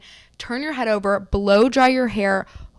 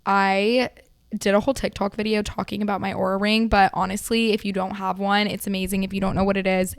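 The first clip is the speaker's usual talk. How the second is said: usual speaking voice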